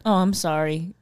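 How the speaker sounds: usual speaking voice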